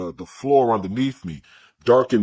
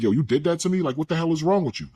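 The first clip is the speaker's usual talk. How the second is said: street-voiced outrage; 6 semitones higher, faster than usual.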